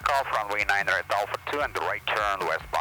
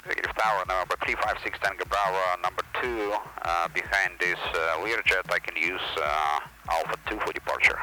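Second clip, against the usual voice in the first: pilot cockpit headset; 11 semitones lower than usual. Real, not acted. Pilot calling tower on radio.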